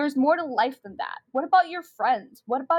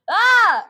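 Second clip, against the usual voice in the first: loud, high burst of fury; a furious loud high shout; angry cry; 9 semitones higher, 11 dB louder than usual. Acted. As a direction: yell as you grab it. Callie grabs the phone.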